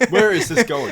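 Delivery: mock alarm. Real, not acted. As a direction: throw the question out in mock alarm.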